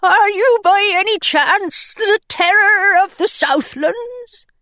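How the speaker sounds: a shivering pirate hoping he's found her; timid pirate. Acted. Cold pirate asks.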